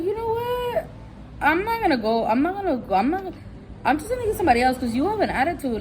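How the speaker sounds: a huffy brush-off, voice up high; indignant. Retold or imitated. Customer tells rude clerk he's leaving, high and indignant. Fed up, walking away from clerk.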